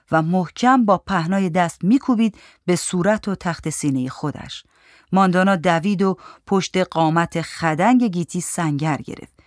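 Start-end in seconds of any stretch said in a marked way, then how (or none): none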